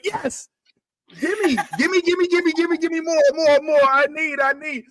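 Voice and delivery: high, eager pleading; mock craving. A viewer hooked on the scene, begging high and eager.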